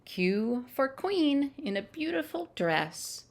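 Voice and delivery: bright, lilting picture-book reading; reading to children. Acted. Storyteller reads the alphabet book to children, lilting. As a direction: read each letter like a treat for little ones.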